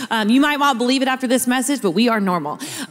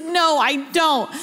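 imitated crying child; 6 semitones higher, slower than usual. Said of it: "she starts crying".

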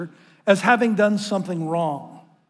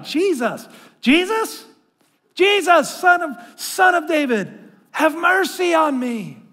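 desperate crying out; 8 semitones higher, slower than usual. Retold or imitated. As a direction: cry out to Jesus for mercy from the roadside. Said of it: "he's crying out".

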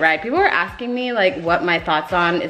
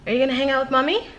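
pet talk; 7 semitones higher, much faster than usual. Real, not acted. Talking to her dog.

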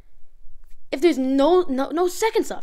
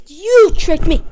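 loud accusation; 3 semitones higher, 10 dB louder, slower than usual. Real, not acted. Accusing the friend.

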